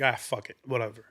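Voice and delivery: low, soft, dismissive aside; quietly giving up; resigned shrug. Retold or imitated. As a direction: toss it off under your breath. Retold shrug about the accent.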